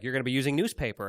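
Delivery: ordinary talk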